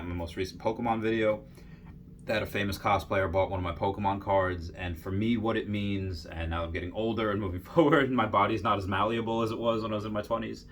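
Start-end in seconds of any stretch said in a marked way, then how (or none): none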